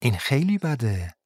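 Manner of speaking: a hint of menace in a soft voice; meaningful tone. A narrator's reading. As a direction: let the words hint at a threat. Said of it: "با لحنی معنیدار گفت".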